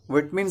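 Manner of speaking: vitamin as "VIT-min"; short first vowel in vitamin; British pronunciation. Shown as a demonstration. Teacher gives an example, vitamin as "vit-min". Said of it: "सही उच्चारण हमारे लिए होगा विटमिन".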